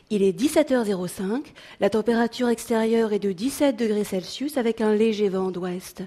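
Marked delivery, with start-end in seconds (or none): none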